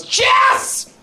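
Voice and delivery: very high scolding yell; mad mama bear. Retold or imitated. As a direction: yell the name to haul a friend back. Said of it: "Then she got really mad".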